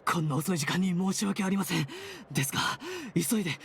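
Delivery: panting, high-pitched urgent report; out of breath. Acted. Guard rushing to the head nurse at night, breathless. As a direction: gasp it out between heavy breaths. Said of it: "深呼吸してから話してちょうだい".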